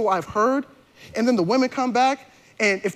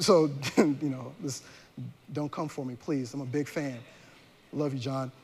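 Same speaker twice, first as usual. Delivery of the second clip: quiet aside; 8 semitones lower, 9 dB quieter than usual. Real, not acted. Playful aside to a famous author.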